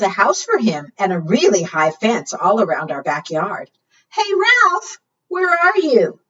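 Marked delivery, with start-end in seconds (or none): 4.1-6.2 much higher call for a lost dog. Acted. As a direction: call across the backyard, high. Owner calling out for dog.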